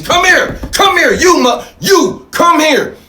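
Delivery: hammed-up master yelling come here; mock master calling out. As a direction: bark for the slave to come back like a master. Master calling the freed slave.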